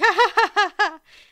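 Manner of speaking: big high cackling laugh; loud laugh. Real, not acted. Laugh track joke.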